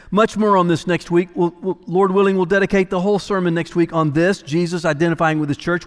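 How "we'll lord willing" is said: ordinary talk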